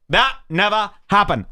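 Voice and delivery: high, emphatic mocking catchphrase; imitated scoffer, played up for effect; Morik. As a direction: spell out the scoff word by word. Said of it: "I love that phrase".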